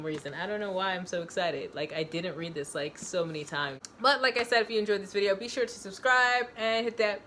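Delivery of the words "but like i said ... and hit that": louder, higher channel sign-off; upbeat outro plug. Real, not acted. Asking viewers to subscribe, upbeat outro.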